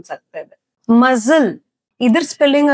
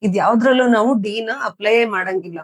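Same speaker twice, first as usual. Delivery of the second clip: normal talking voice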